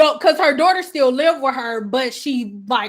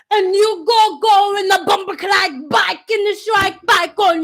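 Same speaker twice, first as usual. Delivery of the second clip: imitating angry client; 4 semitones higher than usual. Client ranting at her stylist, mock furious scolding. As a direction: scold as if throwing her daughter out. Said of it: "that's how she was talking to me".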